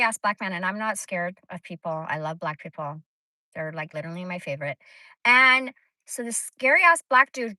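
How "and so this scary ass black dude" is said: emphatic. Real, not acted. Scary man story.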